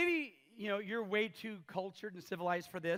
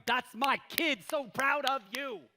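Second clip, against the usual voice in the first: proud parent; 5 semitones higher, 7 dB louder, slower than usual. Pretend. God voiced as proud parent.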